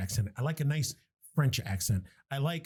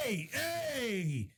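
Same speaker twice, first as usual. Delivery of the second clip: high, drawn-out Scottish "oi, hey"; attempted Scottish accent; 5 semitones higher, much slower than usual. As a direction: botch a thick Scottish accent, then falter. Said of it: "thick Scottish accent".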